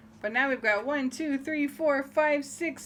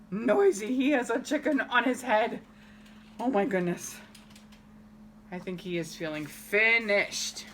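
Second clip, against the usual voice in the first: plain reading voice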